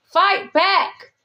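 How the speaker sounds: slow, high imitated order; mean command. God answering a hurt believer, mock-mean order. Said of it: "you basically being mean to me".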